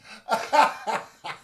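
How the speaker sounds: high burst of laughter; loud laugh. Real, not acted. Laughing at the bar.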